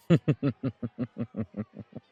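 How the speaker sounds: long, dark chuckle tapering off; villainous chuckle. Acted. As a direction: chuckle loudly, then let it fade. Vampire amused by himself.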